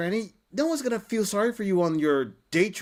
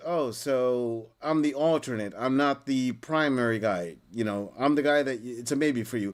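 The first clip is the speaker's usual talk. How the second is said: voicing the alternate guy; 7 semitones lower than usual.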